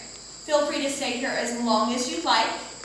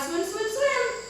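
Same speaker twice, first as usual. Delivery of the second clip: excited; 8 semitones higher than usual. Shown as a demonstration. Kids yoga animal chant.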